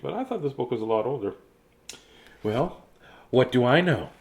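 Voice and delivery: usual speaking voice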